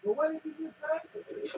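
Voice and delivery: faint, distant voice. Real, not acted. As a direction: far off from the mic. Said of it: "Now you're very faint".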